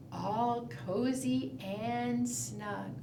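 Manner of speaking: hushed bedtime murmur; soft and slow. Shown as a demonstration. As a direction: let it fade like a lullaby. Bear sleeps, second round.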